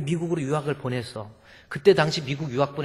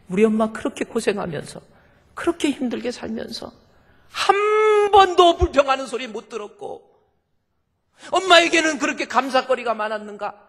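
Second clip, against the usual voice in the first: high, loud sobbing preaching voice; tearful cry; 11 semitones higher, 7 dB louder than usual. Real, not acted. Preacher recounting mother's hardship, crying out loud. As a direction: cry it out through tears.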